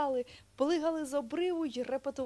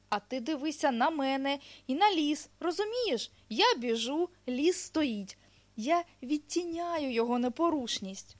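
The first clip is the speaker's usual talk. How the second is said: lightly voiced hedgehog, a bit higher; hedgehog explaining insistently; 5 semitones higher, 5 dB louder than usual. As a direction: explain it patiently but keenly as a hedgehog.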